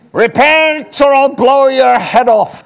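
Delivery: loud, high, slow Scottish shout; mock-menacing yell in a Scottish accent. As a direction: bellow it as a Scottish hellfire threat. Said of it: "I said that in a Scottish accent".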